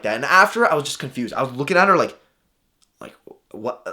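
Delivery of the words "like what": whispered, much softer; breathless disbelief under the breath; shocked whisper; stunned. Retold or imitated. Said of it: "in shock".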